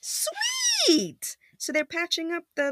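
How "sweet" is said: very high, drawn-out squeal of approval; delighted cheer. Acted. Story character cheering the repair, high and delighted. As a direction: stretch it out way up high.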